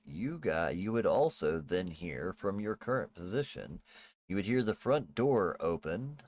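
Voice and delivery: ordinary delivery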